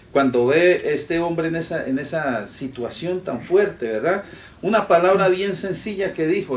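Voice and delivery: ordinary talk, nothing marked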